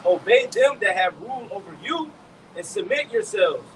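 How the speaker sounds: scripture reading. Acted. Reading Hebrews to the brothers, slow and proclaiming. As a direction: proclaim each phrase slowly, like reading from the pulpit. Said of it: "It says".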